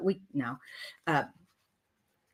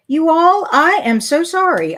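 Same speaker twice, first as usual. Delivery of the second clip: louder, higher, flustered "so sorry"; loud dismayed apology; 9 semitones higher, 10 dB louder than usual. Real, not acted. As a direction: blurt out the apology to the viewers. Realises a mistake on live stream.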